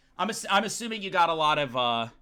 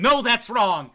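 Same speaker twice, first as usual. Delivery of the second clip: loud, higher mock accusation; imitating accusers; 4 semitones higher, 7 dB louder, slower than usual. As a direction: call it out like an objection. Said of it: "They're pointing fingers and saying".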